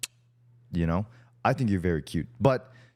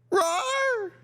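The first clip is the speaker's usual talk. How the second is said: squeaky high mock roar; high-pitched roar. Shown as a demonstration. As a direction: roar like a tiny cute beast. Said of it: "it's a high-pitched roar".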